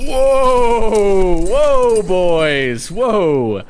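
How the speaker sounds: loud, high whoa to the horses; calling out to horses. Acted. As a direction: holler to calm a stampeding team. Reining in stampeding horses.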